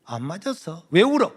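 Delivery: exasperated teacher. Retold or imitated. Teacher pressing the silent student, angry yell. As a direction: yell the question in frustration.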